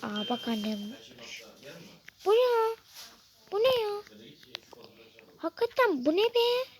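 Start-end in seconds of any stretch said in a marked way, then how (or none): 2.2-6.7 much higher, louder disbelief; shocked. Real, not acted. Girl reacting with a shocked squeal to what mom shows.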